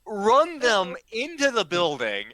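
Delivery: usual speaking voice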